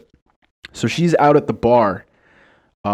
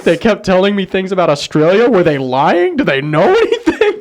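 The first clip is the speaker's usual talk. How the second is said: high, louder mock of a dismayed guy; heartbroken dupe; 8 semitones higher, 4 dB louder than usual. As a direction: play a guy who just learned he was fooled. Heartbroken guy realizing the trick.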